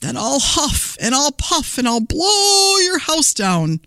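high wolf threat with drawn-out end; lightly voiced wolf, taunting the pig; gleeful storybook wolf. A narrator's reading. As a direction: stretch the threat out in a high, playful voice. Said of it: "laughed the wolf".

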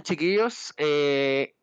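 ordinary delivery

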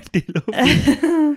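laughing through the words; giggling while echoing. Real, not acted.

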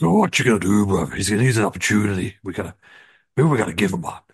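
lightly voiced Hulk Hogan. Hogan tells Triple H to give Disco a chance, mock Hogan bravado. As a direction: lean into a hammed-up Hogan. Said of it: "it's like, Hogan voice".

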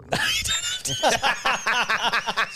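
big burst of laughter. Real, not acted. Laughs at hug joke.

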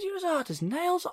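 very high-pitched quoted exclamation; mock astonishment, pitched right up; retold amazement. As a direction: re-enact it in a squeaky-high voice. Retelling own remark to chef.

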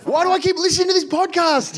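high-pitched mock outrage; ropeable. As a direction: wail it like an exasperated, fed-up listener. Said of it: "just being ropeable".

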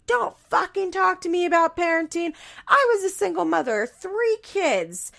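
high, indignant retort; mock angry mother. Grandmother snaps at the boyfriend about parenting, indignant. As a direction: bite back hard. Barbara furious, retold.

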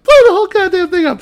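very high, loud, fast screech; shrieking mock echo. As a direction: parrot the threat in a piercing mock-female yell. Retold woman echoing him at higher pitch.

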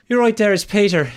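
high, loud Irish caller imitation; hammed-up Irish phone greeting; Irish accent. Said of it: "Hilarious Irish accent".

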